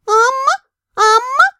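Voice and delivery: high chirpy creature voice; high rising dragon call; dragon chirping. Acted. Dragon calls heroine.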